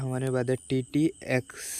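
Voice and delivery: usual speaking voice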